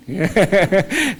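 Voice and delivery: a high, loud burst of laughter; bright joyful laugh before the quote; happy laugh. Real, not acted. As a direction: laugh out with delight. Laughs at his own promise.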